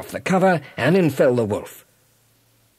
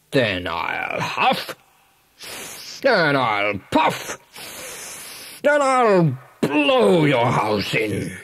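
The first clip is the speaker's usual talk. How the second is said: menacing big bad wolf; 3 semitones higher, slower than usual. Wolf threatening the pig through the door, slow menacing wolf voice. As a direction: drag out every word like a storybook wolf.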